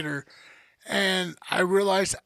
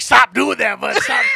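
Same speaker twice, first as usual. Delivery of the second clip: a sharp, loud mock command; retold loud telling-off; 3 semitones higher, 9 dB louder than usual. Patient retelling how he scolded the dentist, loud mock command. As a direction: snap it out like scolding a kid.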